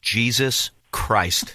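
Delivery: low, slow disbelief; drawn out in shock; stunned. Real, not acted. Shocked at the tuition cost.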